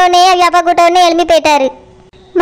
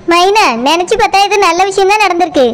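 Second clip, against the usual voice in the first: ordinary talk, nothing marked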